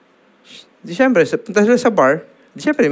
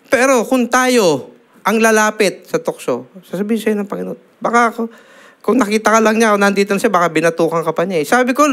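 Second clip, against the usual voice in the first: much higher, pressing rebuke; heated preaching; 8 semitones higher than usual. Acted. Preacher retells rebuking a friend who played with fire, heated. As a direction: scold like a brother warning a friend.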